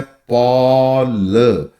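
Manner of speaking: पॉल drawn out very slowly on the all sound; stretched demo. Shown as a demonstration. Teacher slowly showing the all sound in पॉल. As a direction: hold the ऑ in पॉल. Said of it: "all वाली sound आपको इसमें नजर आएगी".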